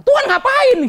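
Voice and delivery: very high, loud complaint to God; mock outcry. Congregant questioning what God is doing, loud mock outcry. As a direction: burst out like an indignant believer. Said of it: "Lalu saudara bilang".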